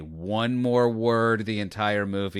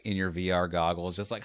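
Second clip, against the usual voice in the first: ordinary delivery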